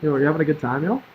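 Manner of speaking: ordinary delivery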